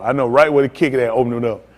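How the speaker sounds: ordinary delivery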